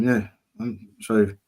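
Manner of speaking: ordinary delivery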